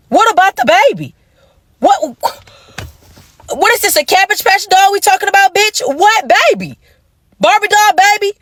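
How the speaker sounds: rising, high, disbelieving questions; incredulous grilling. Retold or imitated. Grilling her man about a baby, high and incredulous. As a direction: demand answers from the man in mock outrage.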